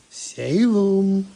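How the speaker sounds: high loud call across the room; calling a cat. Real, not acted. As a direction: call the cat's name out.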